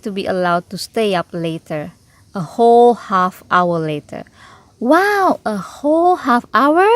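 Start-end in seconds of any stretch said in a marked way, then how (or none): 4.8-6.9 proud wow. Acted. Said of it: "said Sister proudly".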